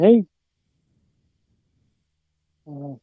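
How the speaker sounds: normal talking voice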